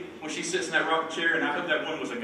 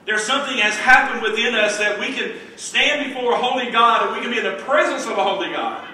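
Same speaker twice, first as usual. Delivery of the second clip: loud, raised preacher's delivery; fervent preaching; 6 semitones higher, 6 dB louder than usual. Acted. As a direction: preach it out to the whole church. Preaches at full force.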